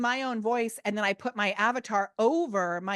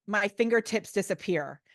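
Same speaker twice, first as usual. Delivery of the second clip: normal talking voice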